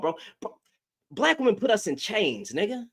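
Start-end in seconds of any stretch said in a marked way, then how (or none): none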